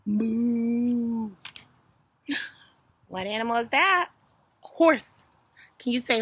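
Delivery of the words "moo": long, level imitated moo; sustained cow call. Shown as a demonstration. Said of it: "What sound does a cow make".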